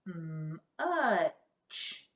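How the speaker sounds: much stretched out long, sound by sound; slow blend. Shown as a demonstration. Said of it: "Blend it with me".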